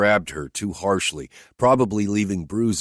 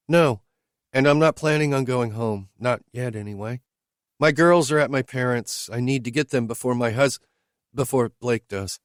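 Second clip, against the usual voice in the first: higher, lightly voiced woman asking bluntly; higher woman's voice, questioning her driver; Whitney's voice; 7 semitones higher than usual. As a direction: lift into her voice, direct and searching.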